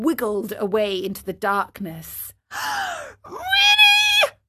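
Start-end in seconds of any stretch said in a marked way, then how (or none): 2.5-3.0 high, quick gasp; sharp intake of shock. Acted. Pinocchio shocked by vanished knocker. 3.2-4.4 very high-pitched shriek; enraged cry. Acted. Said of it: "cried Pinocchio, blind with rage".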